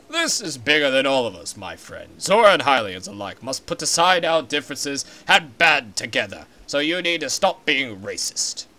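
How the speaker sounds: a deep and solemn giant fish king; stately Zora king. Acted. King speaks again.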